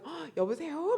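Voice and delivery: a light imitation of grandpa turning sweet on the phone. Pastor imitating husband answering grandkids' call, lightly sweet. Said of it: "목소리가 확 달라진다는 거예요".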